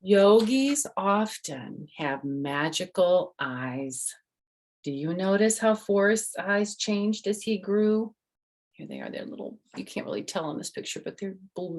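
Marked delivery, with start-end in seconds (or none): 0.0-8.1 bright storybook reading voice; reading to children. Acted. The author reads her book to the kids, bright storytelling. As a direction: read it out to little listeners.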